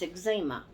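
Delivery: "eczema" as eg-ZEE-muh; her own pronunciation. Shown as a demonstration. Vlogger insisting on the word she grew up with, eg-ZEE-muh for "eczema". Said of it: "But to me".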